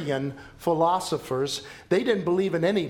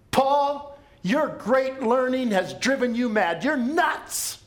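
much higher, louder, scornful governor; scoffing Festus; 9 semitones higher, 4 dB louder, slower than usual. Acted. Governor Festus scoffing at Paul's preaching, high mocking voice. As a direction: dismiss Paul as a madman, mocking. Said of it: "you know what Festus said".

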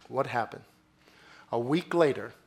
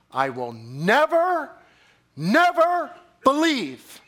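slow, with the second never much higher and louder; emphatic refusal; 11 semitones higher, 6 dB louder, slower than usual. Acted. Thomas refusing to believe the disciples, emphatic slow stress. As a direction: dig in hard on never. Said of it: "Emphatic response".

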